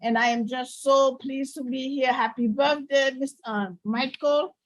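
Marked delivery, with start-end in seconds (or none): none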